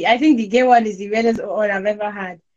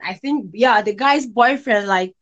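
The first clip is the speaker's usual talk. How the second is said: ordinary talk, nothing marked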